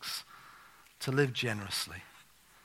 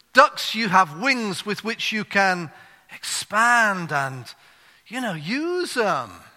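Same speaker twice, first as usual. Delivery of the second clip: very slow, loud, higher preacher voice; hammed-up fervent pulpit voice; duck preacher; 7 semitones higher, 8 dB louder, much slower than usual. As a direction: preach it big and drawn out. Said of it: "the duck preacher".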